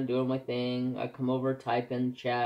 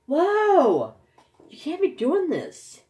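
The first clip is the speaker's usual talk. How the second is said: loud, much higher whoa, then a high protest; retold startled whoa, much higher; alarmed protest; 14 semitones higher, 7 dB louder, slower than usual. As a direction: jump in to stop him, alarmed. Stopping friend typing flirty chat.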